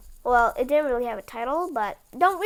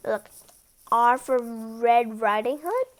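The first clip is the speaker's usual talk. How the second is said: ordinary delivery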